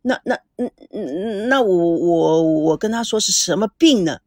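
stuttering, scared and hesitant; frightened stammer. A narrator's reading. Secretary stammering to the furious boss, afraid. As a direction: trip over the words in fear. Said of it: "曹秘书吓得说话都磕巴".

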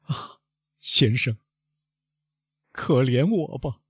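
high, pitiful begging after a faint sigh; sorrowful plea. A narrator's reading. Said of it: "莫雷尔悲伤地问". (悲伤地哀求)

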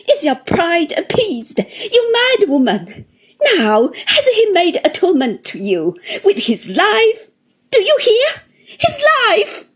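high, loud accusation climbing to a cry; bitter tirade rising in pitch; furious taunt. A narrator's reading. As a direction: confront the mother in frenzied scorn. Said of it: "broke into a frightful laugh".

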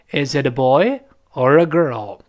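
put-on "boy" goes up, "girl" comes down; rise then fall. Shown as a demonstration. Teacher demonstrating question tune, rising "boy", falling "girl". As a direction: swing up on "boy" and settle down on "girl". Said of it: "boy上去，girl降下来".

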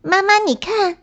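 put-on high-pitched eager child; little bunny's bubbly high voice; excited little rabbit. A narrator's reading. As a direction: call mum to look, high and thrilled. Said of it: "小兔兴冲冲地回来了".

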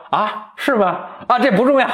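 high, breezy dismissive prince; mock prince. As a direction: wave it off like it doesn't matter. Prince brushes off mistake.

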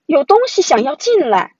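loud, high cry of alarm; frightened shout. A narrator's reading. Brother warning sister during the storm, frightened shout. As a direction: call out loudly in fright. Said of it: "蒂姆喊道".

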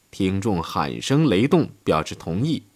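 ordinary talk

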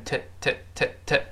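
"t" as a quick, short weak syllable with no full "oo"; weakened "to". Shown as a demonstration. Teacher shows learners how "to" shrinks in fast speech, weakened. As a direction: reduce "to" to a light "t" plus neutral vowel. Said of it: "读快了变t".

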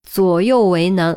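ordinary delivery

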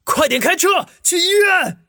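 desperate high shout. A narrator's reading. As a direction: yell at the driver to hurry. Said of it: "双眼赤红地对着开车的警察大吼".

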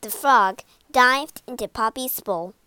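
ordinary delivery, nothing marked

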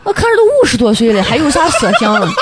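put-on laughing, fast Shaanxi-accented quip. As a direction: toss it off in Shaanxi dialect, laughing. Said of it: "我就用陕西话说啊".

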